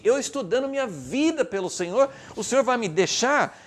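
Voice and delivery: loud, high, impassioned question to God; acted believer's bold challenge; fervent appeal. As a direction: plead your case with total confidence.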